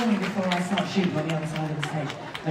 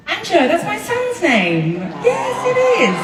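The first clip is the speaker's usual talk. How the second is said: excited; 13 semitones higher, 4 dB louder, slower than usual. Real, not acted. Excited about the son's name.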